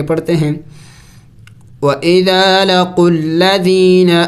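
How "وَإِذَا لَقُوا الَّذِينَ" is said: slow, melodic chanted recitation; teacher reciting the whole verse; Quran recitation. Acted.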